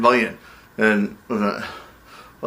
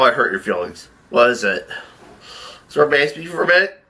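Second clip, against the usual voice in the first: normal talking voice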